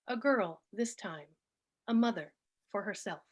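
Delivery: measured poem recital with pauses between phrases; poetry reading. Acted. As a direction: read each line deliberately, letting phrases fall. Reads own poem aloud.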